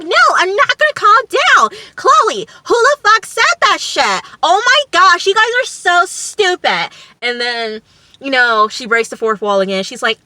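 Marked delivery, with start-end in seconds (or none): 0.0-6.9 loud, very high-pitched imitated fury; going off. Imitating Kara refusing Chloe's plea to calm down, going off. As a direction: refuse at full pitch, hammed-up outrage. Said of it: "And Kara's just going off".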